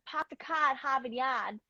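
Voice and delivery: demonstrated Boston accent, dropped R's; put-on Boston accent. Shown as a demonstration. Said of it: "I can get a Boston accent".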